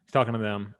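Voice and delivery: ordinary delivery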